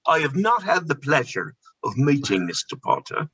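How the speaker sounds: put-on politely deflect in an English accent; English shopkeeper accent. Answering a fan about Mister Potter, polite English accent. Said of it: "I was like".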